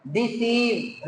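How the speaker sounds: डिसीव slowly, with a long held ee; डिसीव said slowly, ee pulled long; stretched ee. Shown as a demonstration. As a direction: draw out the ee in डिसीव. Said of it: "खींच कर बड़ी ई पढ़ेंगे".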